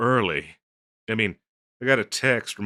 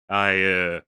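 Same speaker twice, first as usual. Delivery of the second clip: ordinary talk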